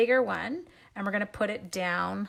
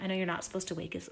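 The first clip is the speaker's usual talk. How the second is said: ordinary talk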